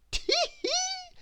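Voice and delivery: very high gleeful tee hee; chortle. A narrator's reading. Mister Happy chortles to himself over his idea. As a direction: giggle with glee at your plan. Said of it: "he chortled".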